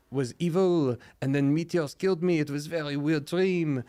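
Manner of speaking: high, exaggerated French accent; french chef. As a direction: ham up a French accent, dazed. Revived French character wakes up.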